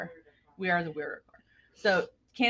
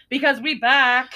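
proud announcement; 4 semitones higher, 8 dB louder, slower than usual. Real, not acted.

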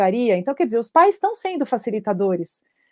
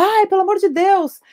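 much higher, mock horrified; appalled mother reacting to crack; shocked parent; 10 semitones higher, 3 dB louder than usual. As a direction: gasp it out like an alarmed parent. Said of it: "os pais arregalam os olhos e falam".

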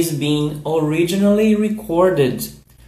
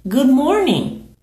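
higher model Good morning; demo for repeating; 8 semitones higher than usual. Shown as a demonstration. Teacher modelling good morning for the class, higher. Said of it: "So repeat".

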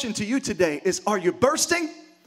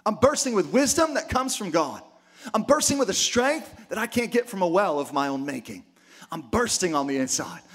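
usual speaking voice

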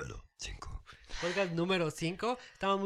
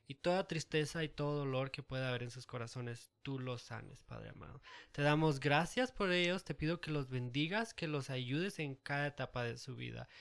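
prayer; 8 dB quieter than usual. Acted. Prayer for listeners.

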